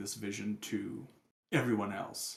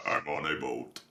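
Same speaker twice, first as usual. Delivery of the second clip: Sledge voice; 10 semitones lower than usual. Pretend. GM sings meme in Sledge voice.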